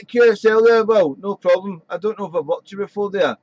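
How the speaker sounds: normal talking voice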